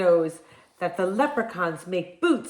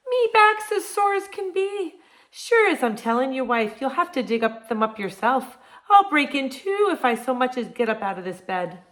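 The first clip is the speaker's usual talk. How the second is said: high whiny complaining Irishman; lazy husband whining to his wife in an Irish accent; 4 semitones higher than usual. Acted. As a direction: moan about his sore back, higher voice. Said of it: "Jamie would whine".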